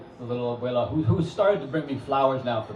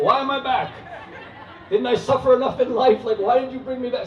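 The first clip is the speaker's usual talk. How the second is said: much higher, louder whining complaint; angry Poe's ghost; 10 semitones higher, 5 dB louder than usual. Retold or imitated. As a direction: moan like a ghost dragged back.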